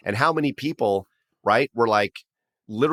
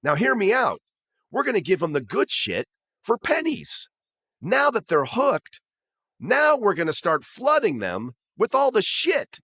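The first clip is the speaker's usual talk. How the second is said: high, eager pitch of a schemer; excited schemer; 11 semitones higher than usual. Retold or imitated. Marketing guy pitching the flipped scheme to the boss, excited and high. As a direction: pitch the big idea, wound up and high. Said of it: "And the guy goes".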